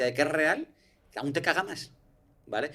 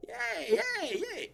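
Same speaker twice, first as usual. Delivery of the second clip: retold shriek; 4 dB quieter, much slower than usual. Reliving his panic in the metal box, high mock shrieks.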